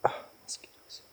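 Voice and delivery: annoyed little whisper. Real, not acted. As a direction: grumble it under your breath. Keeping quiet in woods.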